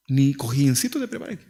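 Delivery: ordinary talk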